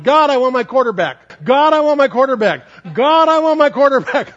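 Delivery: put-on loud, high, building childish yell. A little girl on a swing yelling to God, loud and high. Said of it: "she was yelling".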